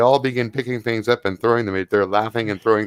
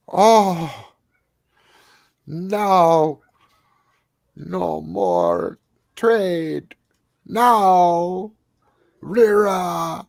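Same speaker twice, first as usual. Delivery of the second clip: menacing creature; 6 semitones higher, much slower than usual. Acted. GM voices hostile creature threatening Rira.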